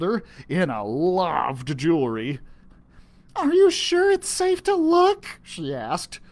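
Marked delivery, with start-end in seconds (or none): 3.4-5.2 high, unsure little voice; timid girl. Acted. Enna asking if it's safe, timid. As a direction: ask it nervously.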